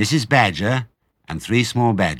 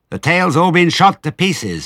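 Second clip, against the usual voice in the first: higher, louder scolding voice; lightly voiced farmer's wife; 8 semitones higher, 5 dB louder than usual. A narrator's reading. Missus Bean scolds Mabel from upstairs, raised. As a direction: bark it down from upstairs.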